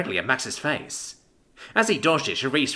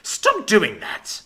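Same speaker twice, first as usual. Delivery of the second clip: slow, sharp angry retort, a bit louder; angry snap; 8 semitones higher, 3 dB louder, much slower than usual. Acted. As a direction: bite the words off in annoyance. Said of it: "Max snapped angrily".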